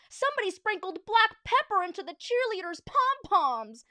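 loud, high holler; high-pitched outraged fan yell; fan's shout. A narrator's reading. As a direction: yell out from the bleachers. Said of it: "shouted a fan in the bleachers".

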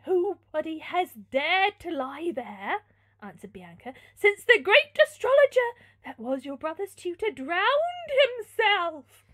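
high, loud, drawn-out horror; hammed-up ghost-story alarm; dramatic frightened maid. A narrator's reading. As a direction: wail it with mock dread. Terrified maid explains haunted room.

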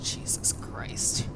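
slow, voiceless whisper; shaken whisper. Real, not acted. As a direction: whisper it after a scare. Recovering from a scare.

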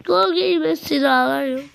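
normal talking voice

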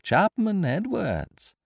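high, slow singsong mimicry of a call; mocking echo. A narrator's reading. Intruder mimics his victim's call for servants, mockingly. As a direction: parrot the shout teasingly, without the volume. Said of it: "echoed the stranger in his turn".

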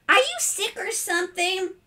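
put-on high, childlike inquiry; innocent boy. Boy asking if his father is ill, high and innocent. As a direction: pipe up cluelessly.

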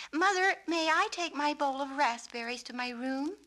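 high, loud eager request; eager asking. Acted. Suki eagerly asking her mother at supper. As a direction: burst out with the idea.

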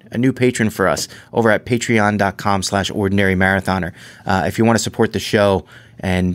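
ordinary delivery

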